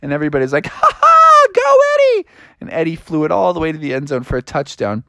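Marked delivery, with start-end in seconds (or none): 0.7-2.3 loud, very high whoop; shrieky crowd cheer. Acted. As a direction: cheer on your teammate with a laugh.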